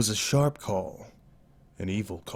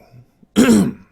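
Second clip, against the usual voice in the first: throat clearing; 12 semitones higher, 16 dB louder than usual. Real, not acted.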